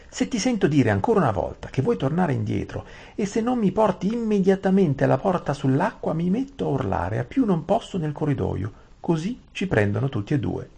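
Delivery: high, firm threatening voice; threatening heroine. Acted. Aravis threatens to scream unless Saralin obeys, firm high.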